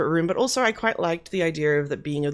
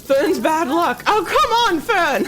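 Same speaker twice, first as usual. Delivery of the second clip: high, loud, half-amused exasperation; exasperated yell; 11 semitones higher, 7 dB louder, slower than usual. Real, not acted. Gamer scolding Fern during fire, exasperated yell. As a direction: wail in frustration at a useless helper. Exasperated yelling at game character.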